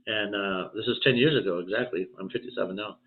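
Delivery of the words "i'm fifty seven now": usual speaking voice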